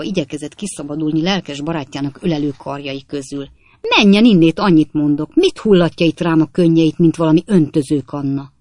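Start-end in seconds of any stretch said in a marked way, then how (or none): 4.0-8.5 angry shout. A narrator's reading. Sam shaking off weeping Trotter.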